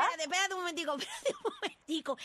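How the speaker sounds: laughing through the words; giggling while she speaks. Real, not acted. Laughing at the news. (riéndose)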